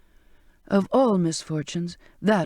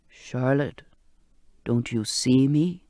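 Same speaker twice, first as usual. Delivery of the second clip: put-on lower, slow insistent old sailor voice; insistent old man; 5 semitones lower, slower than usual. Old cook urging frightened Charlotte to see him. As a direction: press her, old and patient. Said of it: "more insistent than before".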